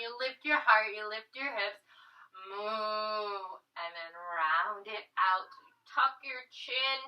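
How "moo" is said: playful moo. Pretend. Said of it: "Imagine you have the cow udders".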